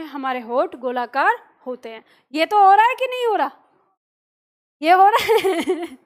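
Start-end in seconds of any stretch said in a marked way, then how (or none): 2.3-3.5 playful challenge. Real, not acted. Teacher teasing class. 4.8-6.0 laughing tease. Real, not acted. Laughs teasing class.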